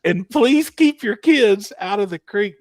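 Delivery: high, loud PA announcement; mock announcer. Imitating Jamie warning the crowd over the mic, loud and high. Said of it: "Picks the mic up immediately and was like".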